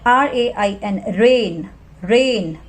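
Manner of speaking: slow spelling, रेन with a long ए; long vowel demo. Shown as a demonstration. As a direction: spell slowly then hold the ए in रेन. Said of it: "ए की long sound".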